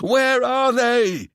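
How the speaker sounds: slow, high furious shout; demanding shout. Acted. Seb spitting a demand at Ewan, slow furious shout. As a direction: spit the demand right in his face. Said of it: "Spittle flew from his mouth ... as he demanded".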